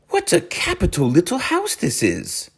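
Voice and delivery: high, bright-toned cheer; cheery call. A narrator's reading. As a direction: call it out happily. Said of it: "he called out cheerily".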